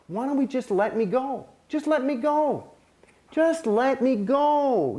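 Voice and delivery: high, slow pleading to be let go; pleading gently. Retold or imitated. Asking the church to release him, high slow gentle plea. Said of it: "I was trying to be nice".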